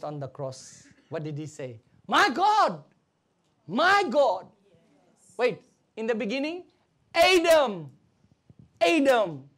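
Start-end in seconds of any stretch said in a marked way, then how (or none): 2.1-4.5 raised, loud cry to God; Jesus crying out. Acted. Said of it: "Jesus cried out". 7.1-9.5 God crying out. Acted. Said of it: "God cried out to Adam".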